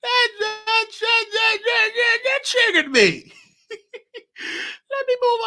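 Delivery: high, laughing through tears; half laughing, half crying, pitch way up; choked-up giggle about to cry; tearful laugh. Real, not acted. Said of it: "I'm just tearing up".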